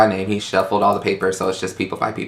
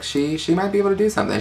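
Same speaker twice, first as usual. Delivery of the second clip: impressed inner voice; 10 semitones higher than usual. Retold or imitated. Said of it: "I was like".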